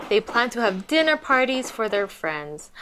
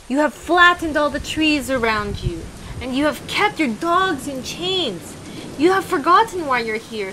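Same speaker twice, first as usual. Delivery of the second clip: hermit scolding; 6 semitones higher than usual. A narrator's reading.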